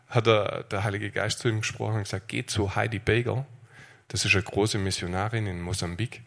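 normal talking voice

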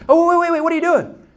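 high, loud, urgent; coach hurrying up. Retold or imitated. Coach rushing in to stop the dad, high and urgent. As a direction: call out as if running up to stop them. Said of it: "He runs up and goes".

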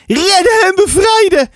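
put-on high, excited children's cry; excited boys. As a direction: chant it like two eager boys. Boys chant their rescue plan.

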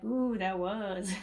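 slow, drawn-out ooh of sudden insight; dawning realization. Retold or imitated. Caregiver realizing her own tone was bossy, slow dawning surprise. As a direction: let the truth sink in slowly. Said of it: "And I'm like".

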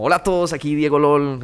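light mock vlogger greeting; old channel greeting. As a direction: imitate your old video hello, brightly projected. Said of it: "entonces yo tenía como".

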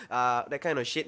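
normal talking voice

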